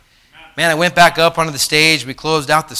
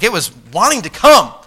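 ordinary talk

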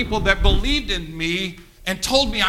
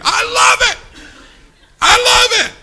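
high laughing delight; 8 dB louder than usual. Real, not acted.

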